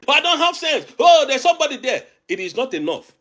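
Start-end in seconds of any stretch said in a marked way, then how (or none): 0.1-2.0 mocking imitation. Mocking people who attacked him.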